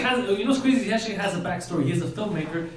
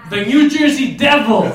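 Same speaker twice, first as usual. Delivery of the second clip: shouted, drawn-out big reveal; triumphant announcement; 8 semitones higher, 11 dB louder, slower than usual. Real, not acted. Host reveals favourite team to the room, triumphant shout. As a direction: belt it out like an arena announcer.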